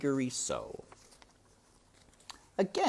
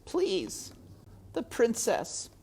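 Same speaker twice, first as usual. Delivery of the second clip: crying; slower than usual. A narrator's reading. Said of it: "and he was crying now".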